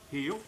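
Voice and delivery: short crisp heel command; one-word heel command to the dog. Shown as a demonstration. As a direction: give the dog a firm heel. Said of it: "Hand signal is like this".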